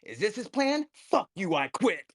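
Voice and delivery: loud mock outburst, starting high; Tom. Teller imitating a colleague quitting the film, fed up. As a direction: act out an exasperated man walking out. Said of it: "And Tom goes".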